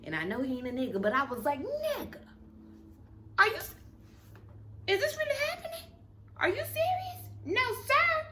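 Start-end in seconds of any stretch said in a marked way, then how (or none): 1.6-7.2 very high, exasperated squeal; shocked disbelief. Retold or imitated. Reaction to man moving closer. 7.5-8.2 very high, loud no sir; high, emphatic dismissal; firm refusal. Retold or imitated. Refusing the creepy man.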